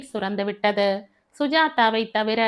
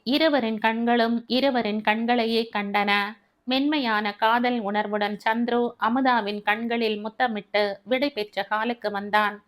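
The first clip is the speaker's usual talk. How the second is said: usual speaking voice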